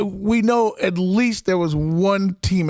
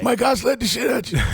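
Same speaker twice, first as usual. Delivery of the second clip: gravelly shout; 4 semitones higher than usual. Retold or imitated. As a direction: bark it like a tough old teammate. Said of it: "he had that gravelly voice".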